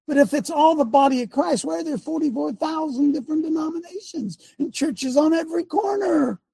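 high-pitched, rising preacher's rant; impassioned challenge. Real, not acted. Preacher challenges the many denominations, impassioned challenge. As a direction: climb high and stretch out the last words.

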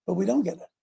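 ordinary talk

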